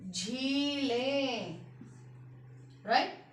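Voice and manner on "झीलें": झीलें drawn out slowly, nasal ें ending clear; slow nasal plural. Shown as a demonstration. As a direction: sound out झीलें for the class. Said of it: "यहां पर भी ऐसे ही बिंदी". (झीलें धीरे और अनुनासिक)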